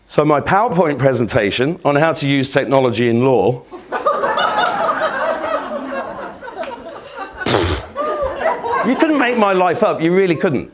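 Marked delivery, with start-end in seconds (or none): 3.7-8.8 long, high-pitched wheezing laugh; high squealing laugh. Real, not acted. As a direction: let it squeal out of you.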